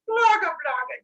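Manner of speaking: high, loud monster babble; monster gibberish. Retold or imitated. Monsters answer being called too ugly to look at, high gibberish. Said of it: "I don't speak monster".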